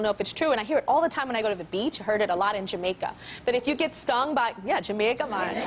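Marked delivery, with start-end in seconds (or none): 4.6-5.6 lightly put-on Jamaican lilt; a playful hint of a Jamaican accent; quick mock Jamaican aside. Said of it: "I don't know what accent that was".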